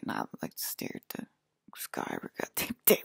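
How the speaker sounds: soft unvoiced whisper. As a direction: whisper it, lost in thought. Whispered stare at sky.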